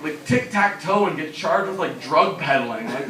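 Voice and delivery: usual speaking voice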